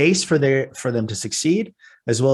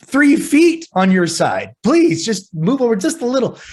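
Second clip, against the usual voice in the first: higher, strained begging, stretched out on three feet; exasperated pleading; 9 semitones higher than usual. Retold or imitated. Father to son learning to drive, exasperated plea. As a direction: plead with the new driver to move over. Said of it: "And it's like".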